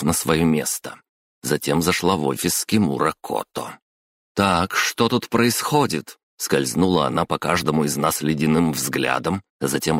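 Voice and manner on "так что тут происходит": slow, higher-pitched question; deliberate, authoritative enquiry; stern demand. A narrator's reading. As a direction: take control of the room, drawing out the words. Manager walks in, demands explanation.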